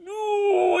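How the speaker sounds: very high excited no; high manager. Retold or imitated. Manager cutting in with news, very high. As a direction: squeal it way up high. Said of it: "he said".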